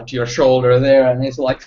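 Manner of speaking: ordinary talk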